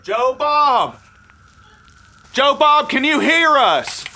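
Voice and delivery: high, loud call into the woods. Acted. Deputy calling for missing Joe Bob, loud call. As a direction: shout for the lost man.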